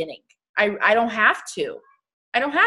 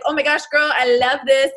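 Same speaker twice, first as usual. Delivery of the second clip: higher, louder gushing in imitated accent; Viri's accent; 4 semitones higher, 5 dB louder than usual. Friend reacting to her page, imitated gushing accent. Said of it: "how she talks to me".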